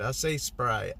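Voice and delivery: "Sprite" as two-syllable SPRY-it; matter-of-fact SPRY-ut for "Sprite"; odd Sprite pronunciation. Real, not acted. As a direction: add a vowel before the T in "Sprite". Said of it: "that's the way I say it".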